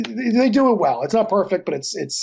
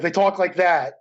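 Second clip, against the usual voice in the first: usual speaking voice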